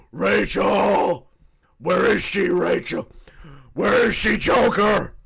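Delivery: loud Batman yelling for Rachel; Batman shouting. Retold or imitated. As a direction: bellow it like an angry Batman. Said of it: "trying to sound scary".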